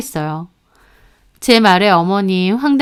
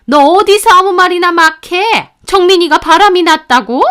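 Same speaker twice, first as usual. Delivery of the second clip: higher and louder, outraged rebuke; indignant scolding; 8 semitones higher, 5 dB louder than usual. A narrator's reading. Mother-in-law rebuking daughter-in-law for slandering her son, indignant. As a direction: snap back in outrage at the accusation.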